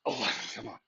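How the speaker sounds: strained, breathy push mid-rep; lifting weights. Real, not acted. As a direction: force it out through the effort. Pushing through a hard rep.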